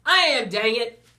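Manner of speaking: exasperated shout. A narrator's reading. Student blurting an answer to the teacher, exasperated shout. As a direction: shout it out in sudden frustration.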